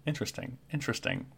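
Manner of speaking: soft muttering to himself, trailing into a whisper; hushed, curious bird muttering; Kenku mumbling. Acted.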